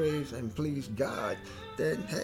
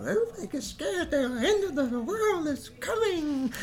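panicky wail; 4 dB louder than usual. Retold or imitated. Mocking panicked people.

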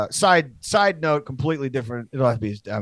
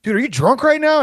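incredulous cop; 11 semitones higher, 5 dB louder than usual. Retold or imitated. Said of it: "And he's like".

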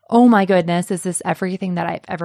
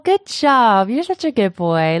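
put-on baby talk; 5 semitones higher than usual. Praising co-host like a pet.

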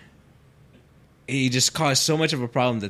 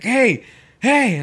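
high, loud double "hey"; calling out; 13 semitones higher, 10 dB louder than usual. Retold or imitated. Homeowner spotting workers taking his stuff, high mock holler. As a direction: imitate a homeowner hollering to stop someone. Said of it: "all of a sudden the guy's like".

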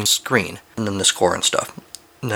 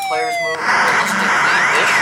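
silly high voice; 4 dB louder, slower than usual. Acted. Joking voice about the game.

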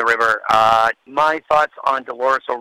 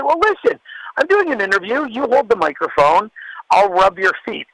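higher, playful retold joke; teasing offer in a higher voice; joking offer; 9 semitones higher than usual. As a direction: toss it off as a cheeky joke to the singer. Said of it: "as a joke".

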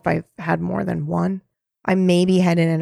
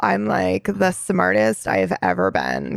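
slow creaky smug voice; smug creaky drawl; vocal fry parody. Shown as a demonstration. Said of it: "the way that I'm speaking".

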